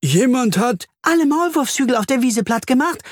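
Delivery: very high, agitated bee voice; excited interjection. Acted. Bee finishes the earthworm's report, excited. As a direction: cut in high and eager.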